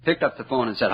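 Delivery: ordinary delivery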